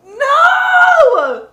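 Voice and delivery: sudden high, loud shocked shout. Real, not acted. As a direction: yell in disbelief. Shocked at the quiz answer.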